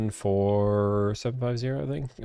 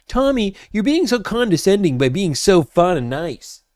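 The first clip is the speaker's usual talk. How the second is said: mocking critic; 8 semitones higher, 4 dB louder than usual. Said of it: "get flack on the internet by saying".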